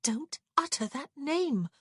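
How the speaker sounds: high, slow warning; tense, drawn-out reproach; scolding. A narrator's reading. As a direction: forbid it in urgent indignation. Woman scolds him after punching him.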